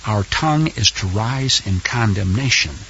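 normal talking voice

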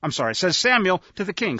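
ordinary delivery

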